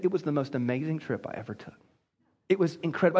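dreamy, hushed delight; a hint of the woman savoring her trip; low, soft gushing. Woman voiced in the joke.

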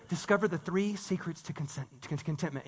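low, hushed headline voice; mock clickbait teaser. Preacher imitating numbered clickbait for the congregation, hushed. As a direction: tease it softly, like secret knowledge.

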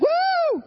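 a high, sudden whoop of churchy excitement; mock excited whoop. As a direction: throw out one big, high "woo". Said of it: "you're just on fire".

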